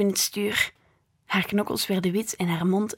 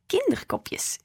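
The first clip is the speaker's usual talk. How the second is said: grinning; 11 semitones higher, slower than usual. A narrator's reading. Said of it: "grijnsde ik".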